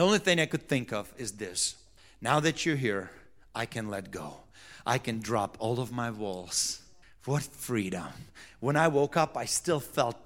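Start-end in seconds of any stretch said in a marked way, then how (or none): 2.2-8.1 softer, lower, a released sigh of a thought; hushed inner voice. Retold or imitated. Pastor recalling his inner relief, hushed. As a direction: let it drop to a quiet murmur.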